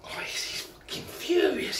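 very high, breathless whispered wife voice; wife remarking on her furious husband in a high whispered voice; wife's voice. Retold or imitated. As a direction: squeak it out as the wife, airy and high.

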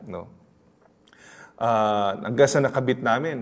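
ordinary talk, nothing marked

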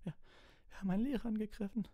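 very high, rushed whimper; thin, panicky squeak. A narrator's reading. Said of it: "wimmerte Hermine".

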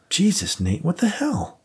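an exasperated cop on the phone; shocked partner. Acted.

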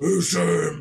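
slow put-on superhero voice; mock the hero's disguised voice, slowly; Batman. Friend shows why the voice changer beats him going like this, mock Batman. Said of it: "more effective than him going".

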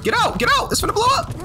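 high panicked yelling; frantic warning to get out; panicked screaming. Real, not acted. Bomb about to explode, warning teammate.

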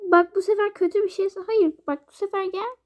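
ordinary talk

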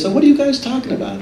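high, quick, innocently curious; playful stranger's question. Retold or imitated. Jesus asking the disciples on the road, high and faux-innocent. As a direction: ask like you don't know a thing. Jesus voiced in retold story.

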